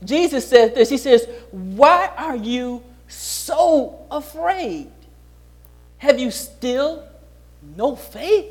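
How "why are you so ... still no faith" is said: slow, soft and tender question; slow down and ask them softly, like a patient teacher; gentle rebuke. Acted. Jesus gently asks the frightened disciples, slow and soft.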